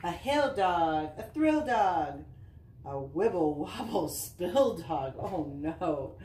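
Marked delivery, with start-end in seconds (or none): 0.0-5.1 bouncy sing-song picture-book reading; reading to children. Acted. Mom reading a dog book to Easton, sing-song read-aloud. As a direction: read the rhymes with a lilt for little kids.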